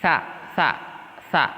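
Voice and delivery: sa said with tongue tip out between teeth; lisped sa. Shown as a demonstration. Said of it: "ujung lidahnya dia agak keluar".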